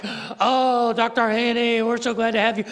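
imitating a gushing greeter in a muddled French-British accent; botched British accent. Restaurant manager greets an honoured guest, gushing in muddled French-British accent. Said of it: "not in a French accent, really British".

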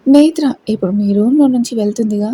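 usual speaking voice

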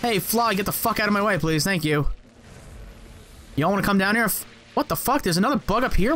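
normal talking voice

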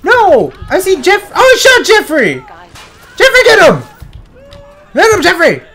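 high, loud frantic cries; gasp, then shout urgently over a fight; frenzied high-pitched calling; panicked yelling. Real, not acted. Panicked combat, companion under fire.

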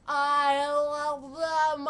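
slow, loud, drawn-out declaration; swooning. Real, not acted. Fan declaring love for the band, slow and loud. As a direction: stretch it out like a swoon.

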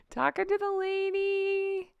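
high, slow amused squeal. Real, not acted. After laugh, amused repeat.

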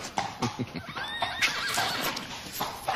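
high airy giggle; breathless giggle. Acted. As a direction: laugh it off haughtily. Snobbish girl refuses the stable.

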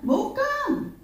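high, soft drawn-out bukan; emphatic no. Real, not acted. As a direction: toss off bukan in a high head voice. Emphatic denial in sermon. (bukan diucapkan tinggi dan panjang)

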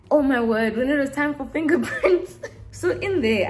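loud laughing exclamation. Real, not acted. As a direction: laugh through the words. Laughing recalling fingerprint scene.

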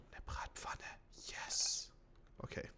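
excited whisper. Real, not acted. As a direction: whisper it to yourself with glee. Whispers over loot.

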